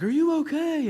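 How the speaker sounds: put-on high, loud little old lady voice; old woman asking. Old lady asks the pulled-out swimmer, in a high voice. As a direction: play the tiny old rescuer.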